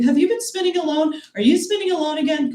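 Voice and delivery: mock worried parent. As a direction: confront like a parent over a habit. Mock concerned parent confronting kid.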